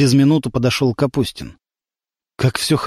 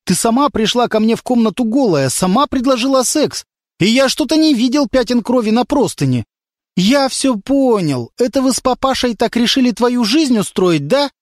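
higher, building to an angry yell; angry shouting; 9 semitones higher than usual. A narrator's reading. Lyonya accuses the girl and demands an answer, shouting. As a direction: accuse her, ending in a shout. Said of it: "закричал Лёня".

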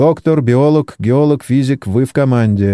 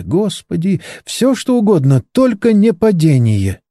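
higher, pleading prayer in panic; frightened plea; 9 semitones higher than usual. A narrator's reading. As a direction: beg God not to crash. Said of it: "лепетала Моника". (испуганная мольба, голос выше)

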